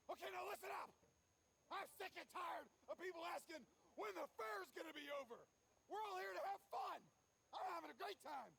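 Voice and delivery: high furious yelling; angry shout. Acted. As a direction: yell at the team, high and loud. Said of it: "Je ne yell. / Kind of yell".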